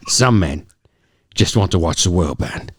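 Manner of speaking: lightly voiced Michael Caine; a hint of Michael Caine's Cockney; Michael Caine impression. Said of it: "I can't do it".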